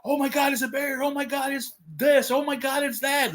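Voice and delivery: high hysterical mock fright; mock panicked kids. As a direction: imitate kids freaking out over a bear. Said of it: "Their mind wandered. It was like".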